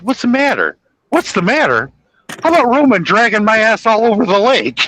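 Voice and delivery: high, strained mock John Candy; quoting John Candy. Retelling a movie scene to a friend, high mock panic. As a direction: squeal it like he's being dragged across the lake. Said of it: "He goes".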